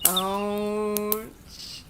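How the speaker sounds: long pained ouch, drawn out; drawn-out wince. Pretend. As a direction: wince through the word. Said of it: "it's making me go".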